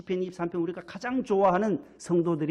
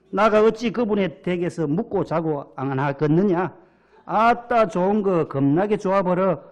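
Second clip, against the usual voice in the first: hearty put-on Jeolla accent, building louder; Jeolla dialect; 5 dB louder than usual. Pastor finishes the Psalm with gusto in Jeolla dialect. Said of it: "이렇게 전라도 버전을 하시는데".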